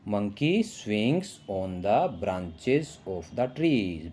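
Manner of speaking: slow, careful dictation pace; slow reading aloud. Shown as a demonstration. Teacher reads the sentence slowly to children. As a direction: read each word slowly for the class. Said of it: "teacher reading English sentence slowly".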